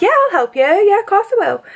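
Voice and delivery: high, sing-song helpful voice; Meg. Retold or imitated. Friend offering a drunk stranger help, high and eager mimicry. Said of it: "Meg's like".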